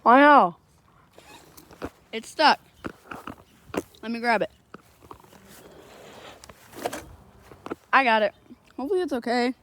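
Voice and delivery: talking around a phone in mouth; phone held in teeth. Real, not acted. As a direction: speak with a phone between the teeth. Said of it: "put the pop socket on my phone in my mouth".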